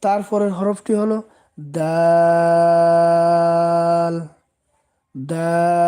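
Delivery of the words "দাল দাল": দাল held very long and a bit louder; three-count stretch. Shown as a demonstration. Teacher showing viewers the long drawn-out dal. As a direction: draw out দাল for three beats. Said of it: "এটা তিন আলিফ টেনে পড়তে হবে".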